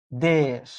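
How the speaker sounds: দেশ said alone, dropping away; syllable demo. Shown as a demonstration. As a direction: finish with দেশ as its own syllable. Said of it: "আবার উচ্চারণ করেন তো". (শেষ শব্দাংশ আলাদা করে)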